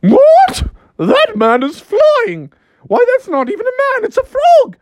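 outraged queen in very high falsetto; Queen of Hearts. Acted. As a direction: screech royal outrage, pitched sky-high. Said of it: "the Queen of Hearts saying".

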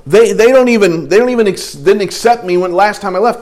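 agitated protest. Acted. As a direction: argue with God as an upset Moses. Moses argues his past to God.

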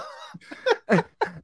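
high-pitched burst of laughter. Real, not acted. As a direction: laugh hard, high and long. Big laugh at reference.

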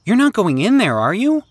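high, anxious question; worried pleading. Acted. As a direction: plead with fear in the voice. Danny pleads with Jimmy.

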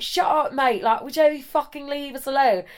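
high, hammed-up English accent; mock-angry English lad voice; English lad arguing. English guy fighting with the man next to us, annoyed English accent. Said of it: "he's having a fucking fight".

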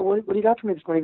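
rattled off fast and eager; eager quick reply. Retold or imitated. Mark asking the coworker for work, fast and eager. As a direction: rush it like a keen new hire. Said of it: "I go".

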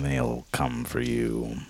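menacing AI voice. Acted. Mock AI ad threat.

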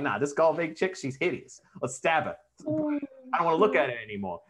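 dismissive mock New Yorker; hammed-up New York-Jersey accent. Podcaster voicing gods who reject Golvig, mock New York-Jersey accent. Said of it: "New Jersey and New York".

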